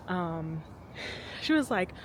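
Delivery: breathless, quiet talk; out of breath. Real, not acted. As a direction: talk while catching breath. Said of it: "I'm a little out of breath".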